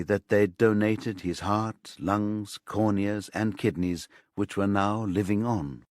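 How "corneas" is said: ordinary delivery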